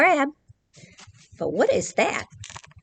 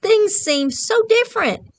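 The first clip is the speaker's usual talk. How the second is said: higher amazed sea dragon; higher wide-eyed dragon voice; 10 semitones higher, 4 dB louder than usual. Acted. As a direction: marvel at the strange world above. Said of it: "So the sea dragon says".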